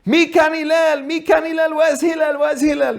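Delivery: high, sustained shouting; imitated screaming. Same man yelling for Hillel at the bathhouse, mock screaming. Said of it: "and then starts screaming again".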